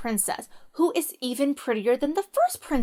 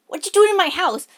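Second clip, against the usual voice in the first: louder, higher troll demand; mock troll roar; 6 semitones higher, 4 dB louder than usual. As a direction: bark it like the troll again. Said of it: "the troll's like".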